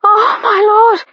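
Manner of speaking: lady crying out at her climax; moaning cry. A narrator's reading. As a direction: let it break out in pleasure. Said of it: "she moaned".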